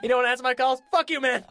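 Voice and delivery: loud, high mock-furious voicemail tirade; angry rant. Producer cursing Kevin out on the answering machine, mock furious. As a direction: blow up at him down the phone.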